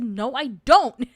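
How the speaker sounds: loud, slow, emphatic refusal; defiant retort. Retold or imitated. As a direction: push back hard, stretching it out. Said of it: "And I said".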